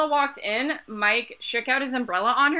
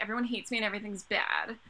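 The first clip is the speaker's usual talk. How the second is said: gloomy Bella; 8 dB quieter than usual. Retold or imitated. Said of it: "she's like".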